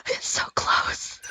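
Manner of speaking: slow, hushed whisper; slow longing whisper; tense whisper. Real, not acted. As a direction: breathe it out slowly, barely daring. Whispering during gacha pull.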